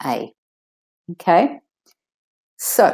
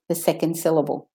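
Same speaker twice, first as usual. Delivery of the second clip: normal talking voice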